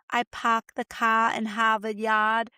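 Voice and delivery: put-on slow, broad Boston accent; mock Boston accent. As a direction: drop the r's like a Bostonian. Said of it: "this crazy Massachusetts Boston accent".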